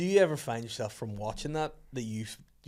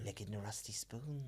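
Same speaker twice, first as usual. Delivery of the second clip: very soft, eerie murmur; creepy soft voice; 3 semitones lower, 13 dB quieter than usual. Retold or imitated. Recalling Salad Fingers, very soft and creepy.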